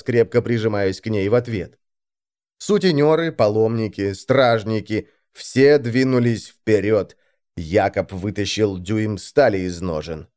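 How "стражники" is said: usual speaking voice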